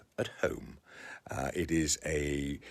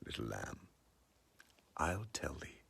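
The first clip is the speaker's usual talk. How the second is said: softer, dropping to a whisper on tell thee; hushed; 3 semitones lower, 11 dB quieter than usual. Acted. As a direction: lean in and confide it quietly. First of repeated line.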